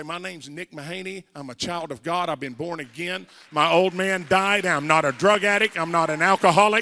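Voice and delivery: testimony rising into a forceful proclamation; defiant declaration. Retold or imitated. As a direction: build from everyday voice to loud preaching. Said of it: "I'd get up and say".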